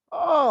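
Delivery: sharp high pained oh; mock pained cry. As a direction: yelp it like a sudden twinge. Pained groan about shoulder.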